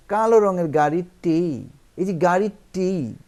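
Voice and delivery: loud, slow stress on গাড়িটি; emphatic stress. Shown as a demonstration. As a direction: stretch the final টি of গাড়িটি. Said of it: "এটাকেই বোঝাচ্ছে".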